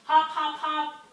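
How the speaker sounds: put-on kids' story hopping; bouncy hop. Frogs leap pad to pad.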